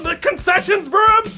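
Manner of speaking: ordinary talk, nothing marked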